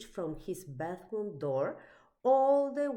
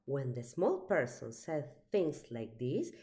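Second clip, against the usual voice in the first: ordinary delivery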